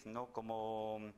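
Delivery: ordinary talk, nothing marked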